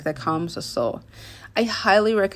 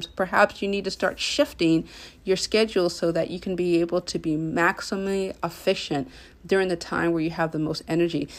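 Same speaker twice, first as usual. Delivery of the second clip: usual speaking voice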